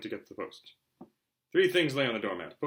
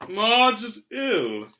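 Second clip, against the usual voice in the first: much higher and louder, very slow; gruff uncle; 9 semitones higher, 10 dB louder, much slower than usual. Acted. Uncle Vernon reading Marge's postcard, slow and loud. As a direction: announce it heavily like a pompous uncle.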